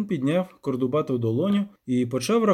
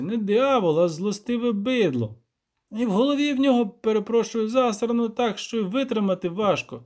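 higher, animated peasant complaint; aggrieved village elder; 6 semitones higher, 3 dB louder than usual. Acted. Elder complaining to the witcher about the devil, higher voice. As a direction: pile up grievances in rustic dialect.

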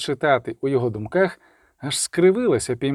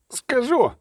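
howled; 9 semitones higher than usual. A narrator's reading. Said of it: "завив чародій".

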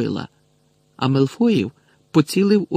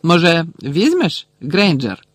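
higher, louder mocking question; taunting offer; 4 semitones higher, 5 dB louder, slower than usual. A narrator's reading. Malfoy holds out a badge to Hermione, taunting. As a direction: needle her with a fake offer.

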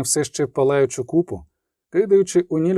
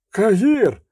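higher, startled recognition; surprised cry; 6 semitones higher, 3 dB louder than usual. Acted. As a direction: cry out the name in sudden surprise. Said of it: "крикнув здивовано". (здивований вигук, вище)

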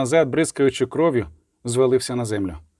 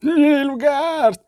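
drawn-out, high panicked shout; high trembling cry; 12 semitones higher, 3 dB louder than usual. A narrator's reading. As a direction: scream the alarm in a high voice. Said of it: "крикнув солдат знову високим тремтячим голосом". (крик високим тремтячим голосом)

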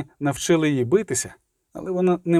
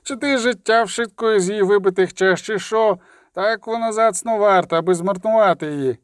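higher-pitched, urgent scolding; shouted reproach; 5 semitones higher than usual. A narrator's reading. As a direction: remonstrate with a reckless comrade in a raised voice. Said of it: "крикнув хтось із хватів".

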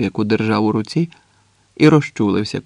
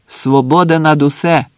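loud, raised-pitch rallying cry; acted militant shout; shouted slogan; 4 semitones higher, 6 dB louder than usual. A narrator's reading. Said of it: "крикнув охочий кидати гранати".

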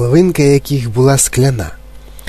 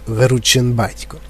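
ordinary delivery, nothing marked

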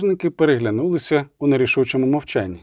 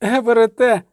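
high-pitched noblewoman's wail; wailed cry; 9 semitones higher, 4 dB louder than usual. A narrator's reading. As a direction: wail the boy's name in alarm. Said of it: "завила шляхтянка". (високий зойк шляхтянки)

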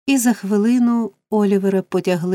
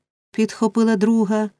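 ordinary delivery, nothing marked

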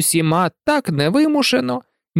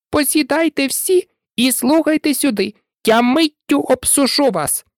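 high-pitched mouse calling to the group; 9 semitones higher than usual. A narrator's reading. Mouse summoning the wet animals, calling out. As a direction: call everyone over in a high voice. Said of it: "гукнула".

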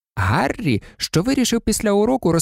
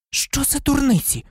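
snapping back; 11 semitones higher than usual. A narrator's reading. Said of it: "огризнулася Герміона".

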